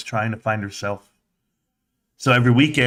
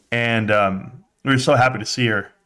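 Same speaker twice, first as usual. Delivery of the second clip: ordinary delivery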